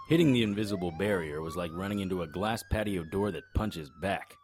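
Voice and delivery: normal talking voice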